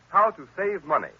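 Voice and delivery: normal talking voice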